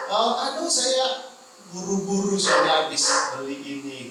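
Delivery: high-pitched mock fluster, settling as it goes; flustered excuse. Man caught out, declining to drop by the church, flustered excuse. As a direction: imitate an embarrassed man begging off.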